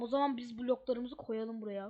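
normal talking voice

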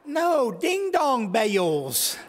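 high, slow Southern drawl; hammed-up Southern correction, vowels stretched out; precious Southern accent. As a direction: draw out bales in a high Southern twang. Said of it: "precious southern accent".

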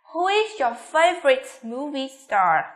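slow question dropping on "star"; falling intonation. Shown as a demonstration. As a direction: ask it with "star" going down. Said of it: "xuống giọng ở cuối câu".

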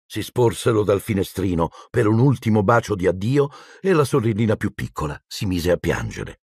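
ordinary delivery, nothing marked

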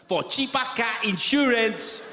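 mock African accent ad voice. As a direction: read the slogan slowly in a hammed-up African accent. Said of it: "should be an African voice".

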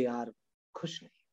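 soft unvoiced admission, nearly a whisper; sad confession. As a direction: let the inner child quietly admit he is unhappy. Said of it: "अंदर का बच्चा जागा और बोले". (धीमे से, लगभग फुसफुसाकर कबूलना)

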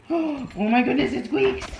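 gasp, then loud thrilled wonder; big delighted pet-talk surprise; excited amazement. Real, not acted. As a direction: gasp and gush loudly for the dog. Repeats it bigger for the dog.

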